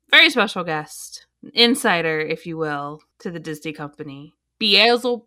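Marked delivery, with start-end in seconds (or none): 4.6-5.2 demon voice. Acted. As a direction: play the demon guest. Guest introduced as Beelzebub.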